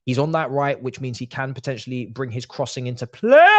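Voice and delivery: ordinary delivery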